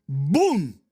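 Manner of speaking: loud, punchy imitated boom; sudden boom. Preacher describing an insight suddenly arriving in counselling, explosive boom.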